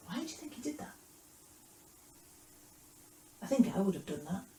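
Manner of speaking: ordinary delivery, nothing marked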